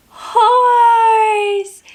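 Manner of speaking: high, loud excited reveal. Acted. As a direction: announce it with delight. Big reveal to child.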